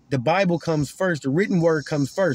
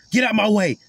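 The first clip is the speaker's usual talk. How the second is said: high, louder, pushy brush-off; mock rude shout; 6 semitones higher, 4 dB louder than usual. Preacher acting a rude man, pushy mock shout. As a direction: bark it like a rude man shoving past. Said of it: "A gentleman doesn't say".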